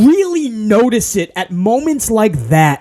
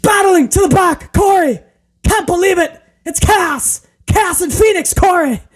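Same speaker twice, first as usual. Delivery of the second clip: very high, frantic commentator yelling in spurts; Kevin Patrick; 11 semitones higher than usual. Retold or imitated. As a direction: parody a hysterical announcer calling the action. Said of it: "He just yells in spurts".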